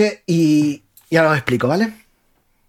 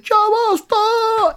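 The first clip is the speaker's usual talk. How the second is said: intensified mock cry; much slower than usual. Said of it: "la intensifica y dice".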